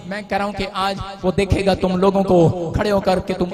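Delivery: loud, rising sermon proclamation; fiery pulpit declaration; full-force preaching. Acted. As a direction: preach it out to the whole hall.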